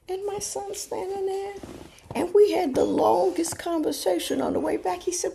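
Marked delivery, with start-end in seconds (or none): none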